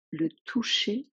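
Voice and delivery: usual speaking voice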